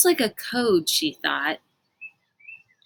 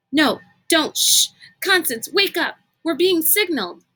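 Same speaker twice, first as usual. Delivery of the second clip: urgent excitement; 8 semitones higher than usual. Acted. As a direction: shake her awake with urgency. Kate urgently waking Constance.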